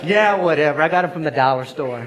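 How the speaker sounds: loud, laughing brush-off; laughing tease. Retold or imitated. Pastor teasing his staff about the dollar-store gag gift, loud and laughing. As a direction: toss it off with a laugh.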